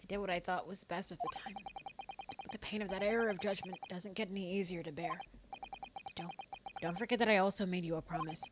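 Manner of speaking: soft, restrained dramatic reading; solemn game character. Acted. Character confessing an error of judgment, soft solemn voice. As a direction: voice regret quietly.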